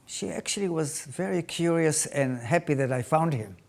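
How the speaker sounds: normal talking voice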